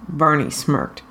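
ordinary delivery

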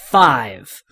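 loud count. Acted. Hero counts, then fires.

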